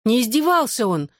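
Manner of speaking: high, insistent outburst; exclaimed protest. A narrator's reading. Girl defends the boy to her mother, crying out. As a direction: blurt out the defence, pitch jumping up. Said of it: "одновременно со мной выкрикнула Шаннон".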